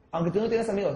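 ordinary talk

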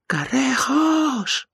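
high, loud, slow drawn-out scream. A narrator's reading. Mikey finally gives up the name to Keller, screaming.

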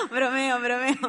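normal talking voice

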